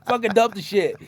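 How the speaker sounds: high, loud exasperated rant; furious rant. Retold or imitated. Driver venting at signaller waving lights, loud angry rant. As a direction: rant at him, fed up and loud.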